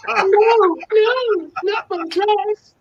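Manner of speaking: very high, hammed-up protest; mock pleading. Guest protests the dress threat, mock pleading. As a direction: play the helpless victim in a high voice.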